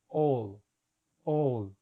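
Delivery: "all" with an open long O; syllable drill. Shown as a demonstration. Teacher breaking alternative into syllables. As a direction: say "all" carefully on its own. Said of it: "La primera sílaba".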